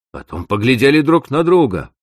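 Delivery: ordinary delivery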